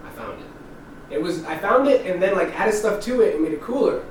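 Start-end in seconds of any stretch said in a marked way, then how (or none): 0.1-1.1 breathy near-whisper, slow, as if confessing a secret; whispered admission. Real, not acted. Owning up to his friends he found the spaceship, whispered. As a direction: let it slip out barely voiced. Admitting the truth quietly.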